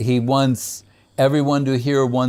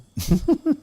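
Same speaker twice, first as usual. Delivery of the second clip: light laugh. Real, not acted. Laughs after "That means you.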